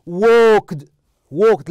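"walked" said loudly with the final consonants sounded; teacher's emphatic demo of "walked", ending clearly audible; pronunciation model. Shown as a demonstration. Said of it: "لاحظ هنا من خلال النطق".

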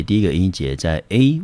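ordinary talk, nothing marked

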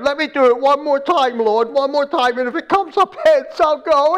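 high-pitched, urgent retold plea; acted-out panicky bargaining, loud and high; scared pleading. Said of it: "really shaken now because he's still a little bit nervous".

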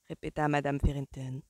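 ordinary delivery, nothing marked

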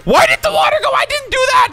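very high, startled protest; bewildered yell. Real, not acted. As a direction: squeal it in disbelief. Bewildered by game event.

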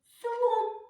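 "слон" said high and loud, one full beat; clear loud demo word. Shown as a demonstration. Teacher modelling the word for children, high and loud. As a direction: call out "слон" clearly for the class. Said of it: "і говоримо".

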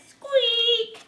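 high-pitched, drawn-out mouse squeak; little mouse. Acted. Parent showing a toddler what the mouse says, high squeak. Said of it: "What does the little mouse say?".

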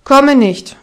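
lauter, störrischer Ruf, „Komme“ betont; trotzig wiederholt. A narrator's reading. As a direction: ruf es bockig zurück. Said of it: "ertönte es wieder". (Peter ruft trotzig vom Berg herunter)

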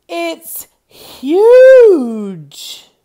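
high, loud gasp of wonder at a giant turnip; a farmer bowled over by its size; farmer's amazed cry. Acted.

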